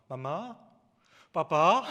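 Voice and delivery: put-on toddler babble rising to a loud squeal; imitating a toddler. Father imitates his baby calling on the phone, loud playful babble. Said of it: "아이가 전화대고 주구장창 하는 말".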